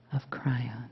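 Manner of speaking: normal talking voice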